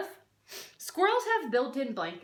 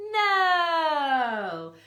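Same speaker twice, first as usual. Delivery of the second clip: loud, drawn-out playful no; a long stretched no to a silly question; emphatic no; 3 semitones higher, 5 dB louder than usual. Shown as a demonstration. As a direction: call out a big teasing no.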